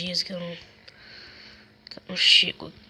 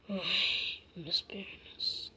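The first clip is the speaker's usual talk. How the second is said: faint aching whisper; sore muscles; 11 dB quieter than usual. Acted. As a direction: moan about aching legs. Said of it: "minhas pernas estão doendo".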